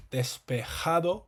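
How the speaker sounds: Despejado with the J breathed like a rough English H, slowly; slow Despejado, J said as a throaty h; Despejado, the J a breathy h. Shown as a demonstration. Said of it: "The J makes a h sound".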